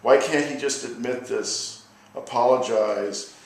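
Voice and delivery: measured, weighty poem recital; a poet performing his anti-war poem; poetry reading. Acted. Reads his war poem aloud.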